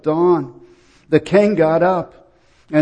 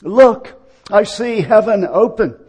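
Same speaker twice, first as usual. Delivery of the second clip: high, loud cry of revelation; exclaiming; 5 semitones higher, 6 dB louder than usual. Acted. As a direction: call out as if seeing a vision. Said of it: "can exclaim at death".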